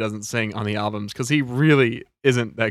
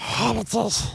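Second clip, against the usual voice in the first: high, hushed creature voice; Gollum; 10 semitones higher, 4 dB quieter than usual. Acted. Answering a question about hobbit accents as Gollum. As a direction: squeeze it into a high Gollum impression. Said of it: "That is a Gollum".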